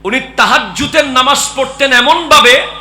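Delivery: very loud, high-pitched exhortation; full-force preaching. Real, not acted. Preacher extols Fatima's night prayers, full-force preaching. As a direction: belt it out to the whole gathering.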